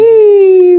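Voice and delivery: long, high, loud squeal; excited squeal. Real, not acted. Cheering over yesterday's cake, long excited squeal. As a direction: let out a sustained high whoop.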